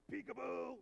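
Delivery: booming "Peekaboo" said too loud; loud peekaboo that frightens a baby; scary loud peekaboo. Retold or imitated. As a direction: boom it out so it scares the baby. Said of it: "scare the living daylights out of her".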